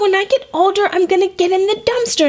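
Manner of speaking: put-on high, stubborn little girl; insistent toddler. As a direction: repeat it like a kid who won't back down. Toddler repeats louder, insisting.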